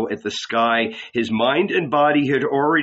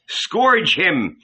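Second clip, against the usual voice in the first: higher-pitched, forceful command; giving an order; 6 semitones higher than usual. A narrator's reading. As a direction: order the guards sharply. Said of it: "he ordered".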